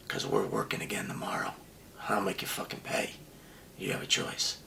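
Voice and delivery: low menacing whisper straight in his face; a quiet, deadly whispered warning; whispered threat. Retold or imitated. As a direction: hiss the threat so the kid can't hear.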